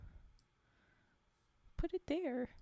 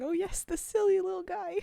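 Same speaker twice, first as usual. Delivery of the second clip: higher, louder mock fangirl cry; imitated excited screaming; 5 semitones higher, 6 dB louder than usual. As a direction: imitate a friend exclaiming over something cute. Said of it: "Vi would be screaming".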